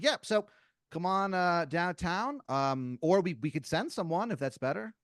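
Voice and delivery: high, loud, breezy phone manner; casually arrange a pickup, with hesitant ums; eager corporate caller. Acted.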